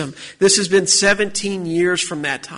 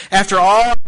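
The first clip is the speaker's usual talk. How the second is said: higher-pitched, insistent listing of grievances; hurt protest; 3 semitones higher than usual. Acted. Preacher voicing Joseph's thoughts on his brothers' doubt, indignant. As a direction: protest all you have done for them, item by item.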